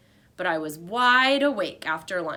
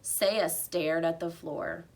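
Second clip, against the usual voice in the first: plain reading voice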